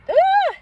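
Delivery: sudden high, loud cry of hurt; real anguished cry; choked cry. Real, not acted. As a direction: burst out as the tears come. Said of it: "I'm not gonna cry".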